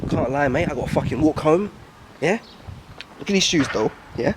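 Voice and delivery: ordinary talk, nothing marked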